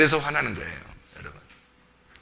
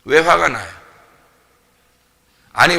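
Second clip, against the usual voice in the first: ordinary delivery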